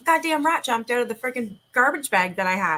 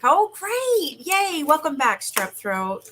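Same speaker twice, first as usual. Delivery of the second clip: mock cheer, much higher and louder; sarcastic; 7 semitones higher, 4 dB louder, much slower than usual. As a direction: fake delight at bad news. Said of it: "I'm like".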